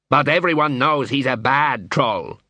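usual speaking voice

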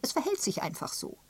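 high, insistent statement; sharp retort. A narrator's reading. Miss Prescott defending her remark to the canon, sharp. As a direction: insist on it, brooking no argument. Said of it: "entgegnete Miss Prescott scharf".

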